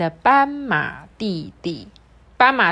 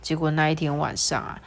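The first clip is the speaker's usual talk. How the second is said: plain reading voice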